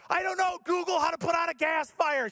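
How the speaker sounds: high, yelled instruction; mock frantic exasperation. Husband telling wife to look it up, high frantic mock yell. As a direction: snap back in high mock panic.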